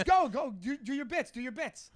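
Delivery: high, loud mock urging; urgent prodding. Host pushing a comic to perform, insistent.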